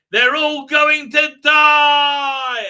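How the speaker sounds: slow, loud, high mock-dramatic line; a slow, loud, high hammed-up doom line. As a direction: draw it out like a trailer voice. Dramatic reveal in recap.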